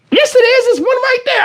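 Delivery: put-on high, insistent little-girl voice. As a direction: play the daughter proving dad wrong. Daughter voiced pointing it out.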